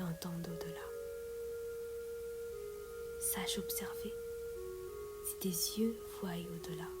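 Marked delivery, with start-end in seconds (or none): none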